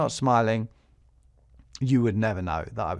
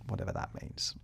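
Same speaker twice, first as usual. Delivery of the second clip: whispered throwaway aside, much softer; wry whisper; 5 semitones lower, 12 dB quieter than usual. Real, not acted. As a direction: drop to a whisper, half to yourself. Wry aside after odd name.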